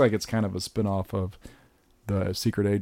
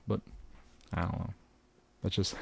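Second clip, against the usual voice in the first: usual speaking voice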